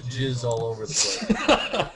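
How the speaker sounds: laughing through words. Real, not acted. Laughing while saying crude phrase.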